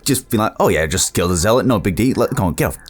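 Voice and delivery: ordinary delivery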